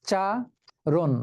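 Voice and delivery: উচ্চারণ slow, উচ্-চা-রণ each beat apart; teacher drawing out উচ্চারণ syllable by syllable; syllables split. Shown as a demonstration. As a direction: tap out উচ্চারণ in three beats. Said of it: "তিনটি অক্ষর।".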